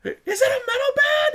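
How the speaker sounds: high, loud yell across lanes; shouting over traffic. Retold or imitated. Yelling from the car at the van driver on the freeway. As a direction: holler it out the car window. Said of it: "I'm screaming back and forth".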